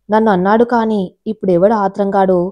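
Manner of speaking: usual speaking voice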